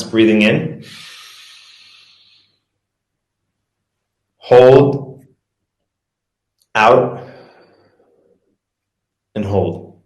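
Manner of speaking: slow counted cues for breathing along; guided breathing count. Shown as a demonstration. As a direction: lead the class through each breath. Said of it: "do it with me right now".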